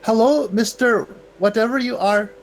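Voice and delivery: slow loud call across the pit. Acted. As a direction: call out loudly and slowly. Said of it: "I will call out to him".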